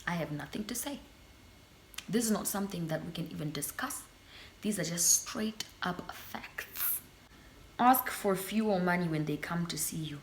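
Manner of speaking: a dry, whispered aside. Real, not acted. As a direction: let it slip out under your breath. Whispered scorn.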